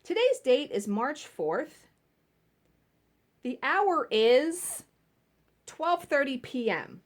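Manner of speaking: slow, careful school PA reading; slow mock intercom announcement. Shown as a demonstration. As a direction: read it out like the morning announcements. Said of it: "You're supposed to say".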